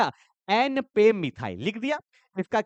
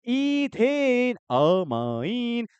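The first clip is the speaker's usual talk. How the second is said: drawn-out answer; much slower than usual. Shown as a demonstration. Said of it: "इसका नाम नहीं तो क्या हो जाएगा?".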